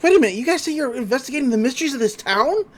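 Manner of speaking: high, fast, excited questioning; eager excitement. Retold or imitated. Dipper running up to the agents, acted eager excitement.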